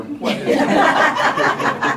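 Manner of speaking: slow, airy, laughing; laughing mock question. Preacher imagining video viewers asking, laughing. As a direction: drag it out through laughter. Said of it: "everybody on the video thing".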